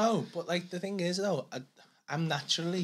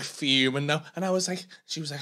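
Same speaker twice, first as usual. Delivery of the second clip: ordinary talk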